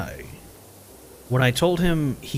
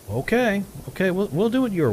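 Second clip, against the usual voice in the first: mock giving in; 8 semitones higher, faster than usual. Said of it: "And the guy was like".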